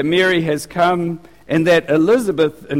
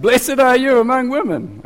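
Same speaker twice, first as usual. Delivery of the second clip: higher-pitched mock yell; raised, exclaiming voice; yelled blessing; 7 semitones higher than usual. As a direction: call it out like a proud shouter. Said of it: "She yelled out".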